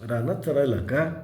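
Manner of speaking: plain reading voice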